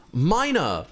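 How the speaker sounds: high-pitched, hammed-up self-congratulation; delighted realisation. Gamer re-enacting finding a mining pun for a title, mock-triumphant. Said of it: "But then I was like".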